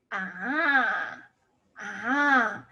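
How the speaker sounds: long "Ah" with a turning point in its pitch; third tone. Shown as a demonstration. Teacher moves body to show the tone, long bending "Ah". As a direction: stretch "Ah" and bend it like a wave. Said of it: "It goes down and up".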